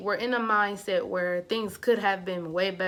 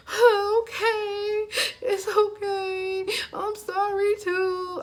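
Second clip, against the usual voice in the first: high, drawn-out tearful whine; mock crying; 13 semitones higher, 4 dB louder, much slower than usual. Forgiving the ex who came back, mock tearful wail. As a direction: wail it slowly in an exaggerated sob. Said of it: "I'm just crying".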